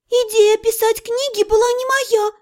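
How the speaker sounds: thin high protesting squeak. A narrator's reading. Mage disowning the book idea, high squeak. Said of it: "пискнула я".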